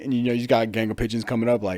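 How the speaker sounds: ordinary talk, nothing marked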